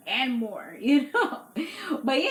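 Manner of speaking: giggling through the words; laughing. Real, not acted. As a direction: laugh it out, real amusement. Laughing at the show.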